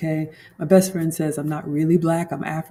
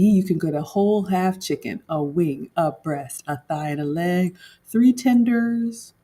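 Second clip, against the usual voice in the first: ordinary talk, nothing marked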